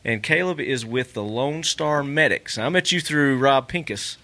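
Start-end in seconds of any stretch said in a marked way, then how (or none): none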